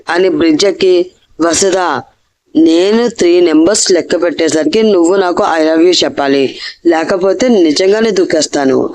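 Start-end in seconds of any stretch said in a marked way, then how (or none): none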